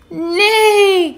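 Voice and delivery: high, drawn-out pretend neigh; whinny the word in a high kitten voice; little cat playing horse; kitty neighing.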